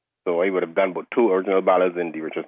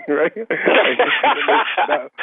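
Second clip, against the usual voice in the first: high bursts of laughter; laughing; 8 semitones higher, 4 dB louder than usual. Real, not acted. Laughing at his cracked voice.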